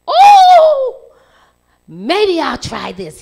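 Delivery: high, loud delighted burst with a laugh; a boy lighting up with a new idea, laughing; excited sudden idea. Acted. Boy bursting out to his dad, delighted and laughing.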